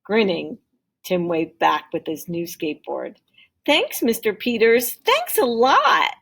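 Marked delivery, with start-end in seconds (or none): 3.6-6.1 high, loud, stretched grateful cry; joyful thanks. Acted. Tim thanks Mister Peters for the skateboard, high joyful cry. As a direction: beam your thanks across the street.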